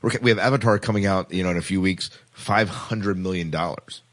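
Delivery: ordinary talk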